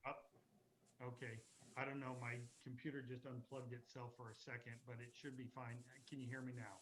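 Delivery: faint voice over a dying mic; barely audible, hissy line; quiet voice loud hiss. Real, not acted. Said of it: "you're so quiet".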